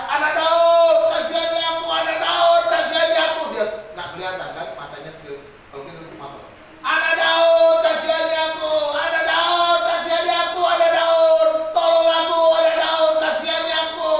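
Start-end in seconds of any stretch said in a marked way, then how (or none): 0.1-3.4 loud, high cry for mercy, held on one pitch; shouted plea. Acted. Blind beggar calling to passing Jesus, shouting nonstop. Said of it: "Terus teriak". 6.8-14.1 loud, high cry for mercy, held on one pitch; shouted plea. Acted. Blind beggar calling to passing Jesus, shouting nonstop. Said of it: "Teriak-teriak terus".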